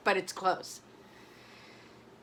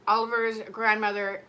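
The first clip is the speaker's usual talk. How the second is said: ordinary delivery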